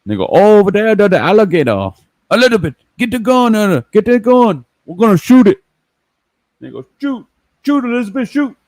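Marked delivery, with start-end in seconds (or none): 0.3-5.6 high, loud Cajun swamp-hunter voice; hollering like a Cajun gator hunter; Cajun impression. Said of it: "Toy Landry impression". 7.0-8.5 high Cajun yell to shoot; urging her to fire, Cajun style; high Cajun holler; Cajun impression. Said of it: "Toy Landry impression".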